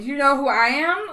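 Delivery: loud, mock self-important challenge; playfully cocky drunken question; tipsy bragging. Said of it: "doesn't help that I had been drinking".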